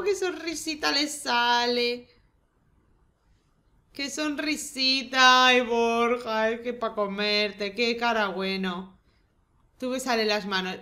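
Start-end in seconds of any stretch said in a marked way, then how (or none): 0.0-9.0 slow fond cooing. Real, not acted. Cooing at the Sim.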